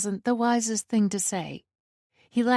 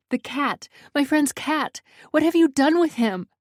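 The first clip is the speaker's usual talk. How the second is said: high, agitated, building louder; panicked demand; 7 semitones higher, 4 dB louder than usual. A narrator's reading. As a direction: climb in pitch and force, frantic for an answer. Panicked woman confronts intruder.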